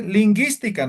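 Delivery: "lingüística" as "lin-ghee-stica", hard g, no w; silent u in GUI. Shown as a demonstration. Teacher correcting the class, "lingüística" said with silent u. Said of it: "para que se pronuncie como gui".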